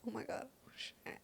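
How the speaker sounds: very soft, near whisper; tiny imitated swear under the breath; hushed first swear. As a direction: let it fade to barely audible. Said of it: "I think I said shit 'cause I was like".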